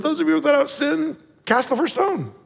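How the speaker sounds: high, lightly voiced Jesus; Jesus' challenge. Jesus answering the accusers, gentle challenge. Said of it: "He said".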